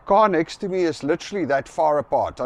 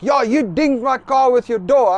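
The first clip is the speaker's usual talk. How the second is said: higher, louder insistent accusation; imitating the pushy owner; aggro insistence; 8 semitones higher, 5 dB louder than usual. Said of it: "this guy is just super aggro".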